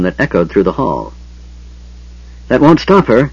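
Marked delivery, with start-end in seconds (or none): 2.5-3.3 high, loud panicked cry. A narrator's reading. Frightened man crying out as the door slams, panic-stricken. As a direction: cry out in terror. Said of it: "Eben cried, panic-stricken".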